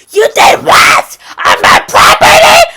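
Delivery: high, loud, slow shrieking outrage; livid scream. Retold or imitated. Landlord yelling at the tenant, shrieking furious. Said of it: "She's fucking livid".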